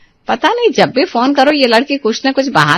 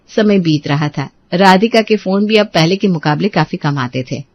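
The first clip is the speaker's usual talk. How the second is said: story narration; 4 semitones lower than usual. A narrator's reading. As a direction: let time pass in the telling. Narrator between dialogue scenes.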